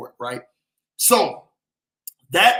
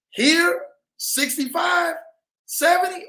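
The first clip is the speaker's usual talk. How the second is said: high, loud hype about the heat; excited shout; 11 semitones higher, 3 dB louder, slower than usual. Real, not acted. Bragging to viewers about Denver's warm winter, excited high shout. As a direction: belt the numbers out with glee.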